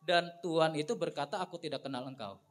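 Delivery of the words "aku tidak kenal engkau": low, soft, cold verdict; stern rejection. A narrator's reading. The Lord answering the virgins, low and soft. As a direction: shut them out quietly.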